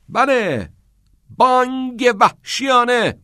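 high, loud, drawn-out command; loud urging. A narrator's reading. Teacher demands a yawp from shy pupil, loud urging.

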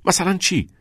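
angry retort. A narrator's reading. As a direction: fire it back angrily. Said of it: "با عصبانیت پاسخ داد".

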